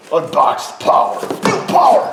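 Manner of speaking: loud, high, drawn-out hype yell; mock power shout. Unboxing a new starter, mock booming shout. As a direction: bellow it like an over-the-top product slogan.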